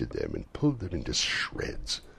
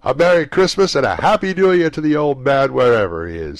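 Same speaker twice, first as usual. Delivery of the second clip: put-on high, loud, fast cheerful young man; cheerful nephew; 10 semitones higher, 13 dB louder than usual. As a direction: toast with hearty good cheer. Nephew's toast.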